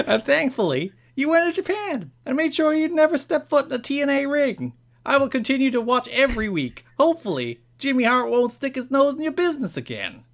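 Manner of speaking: high, loud cartoon New Jersey accent, cracking into laughs. Reading a fan's letter to Jarrett in a New Jersey accent. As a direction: read the fan letter like Joey Wheeler. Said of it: "read this in a New Jersey accent".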